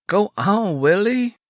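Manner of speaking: cajoling. A narrator's reading. Sister urges her brother out the door, cajoling. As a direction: coax him along with a lifted voice. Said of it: "Mary cajoled".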